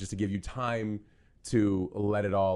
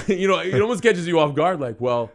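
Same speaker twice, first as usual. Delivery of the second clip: laughing; 9 semitones higher, 12 dB louder than usual. Real, not acted.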